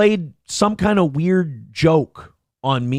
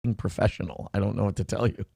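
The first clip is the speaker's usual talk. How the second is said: low, dry put-on lawyer voice, softer; lawyer's voice; 6 semitones lower, 8 dB quieter than usual. Lawyer scoffing at fans' legal takes, low deadpan voice. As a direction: play the unimpressed lawyer deadpan.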